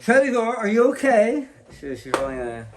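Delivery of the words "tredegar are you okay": put-on a light Boston accent, higher. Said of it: "she'll do it in the Boston accent".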